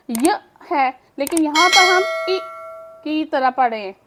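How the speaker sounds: ordinary talk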